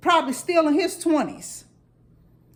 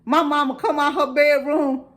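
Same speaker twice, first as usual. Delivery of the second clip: ordinary delivery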